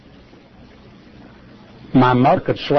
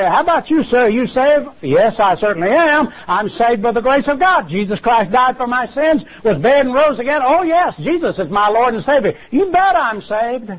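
higher, eager voice of a saved man answering; confident believer; 7 semitones higher than usual. Retold or imitated. A saved man answers the preacher's question, higher and eager. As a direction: answer proudly, sure of your faith.

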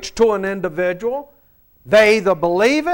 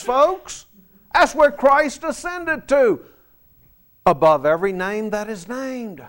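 ordinary delivery

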